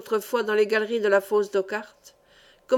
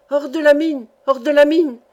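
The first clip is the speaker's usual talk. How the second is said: raised, louder urgent shout; narrator's higher, forceful alarm call; cry of alarm; 4 semitones higher, 8 dB louder than usual. A narrator's reading. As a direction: cry out a warning to everyone. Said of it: "cria l'ingénieur".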